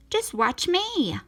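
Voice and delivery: lightly voiced Scuttle. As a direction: show off a little, drawn out.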